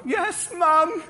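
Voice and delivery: put-on boy in bed answering his mum, high sheepish child voice; meek child reply. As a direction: answer like a hopeful little boy. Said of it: "I was like".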